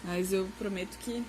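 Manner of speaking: ordinary delivery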